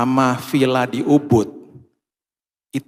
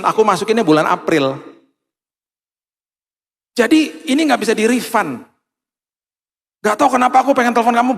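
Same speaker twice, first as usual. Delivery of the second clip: high, loud, rushed chatty friend; excited friend on phone; 9 semitones higher, 5 dB louder than usual. Retold or imitated. Friend calling the wife, excitedly offering the villa. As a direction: gush it out like an eager friend on the phone.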